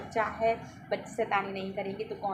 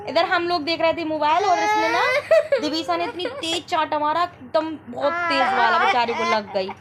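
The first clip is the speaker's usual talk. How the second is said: raised voice; 9 semitones higher, 11 dB louder than usual. Real, not acted. Explaining over crying children.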